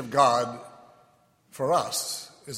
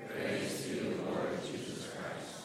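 faint response from the pews; murmured far-off reply; distant congregation; 8 dB quieter, slower than usual. Real, not acted.

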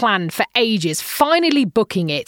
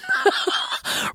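breathless giggle; 10 semitones higher, 6 dB quieter than usual. Real, not acted. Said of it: "laughing at compliment".

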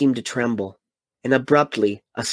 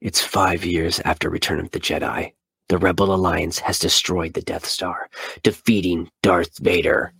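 movie trailer read; 5 semitones lower than usual. Shown as a demonstration. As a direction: announce it like a film trailer. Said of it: "I have to read it like this".

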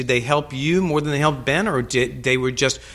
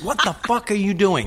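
mock angry boss, pitched higher; 7 semitones higher than usual. Boss repeats it, louder.

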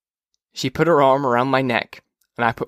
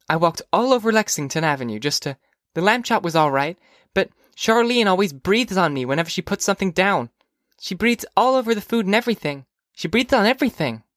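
put-on high, griping child voice; complaining young girl; 7 semitones higher than usual. Phoebe complaining about Charlene and the food, high girlish grumble. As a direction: complain to mother as a little girl, rising higher.

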